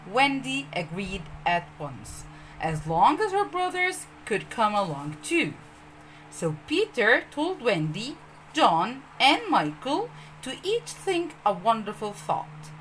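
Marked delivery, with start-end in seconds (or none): none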